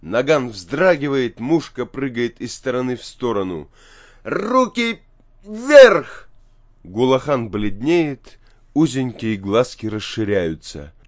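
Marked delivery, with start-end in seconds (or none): 4.2-6.3 high shout bursting louder on the last word; desperate high-pitched armed threat; frantic command. A narrator's reading. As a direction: yell the order, pitch way up. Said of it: "кричит не своим голосом".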